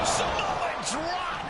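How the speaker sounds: high, slow call of the move; drawn-out call. Real, not acted. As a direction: stretch out the move name. Commentator calling the finisher.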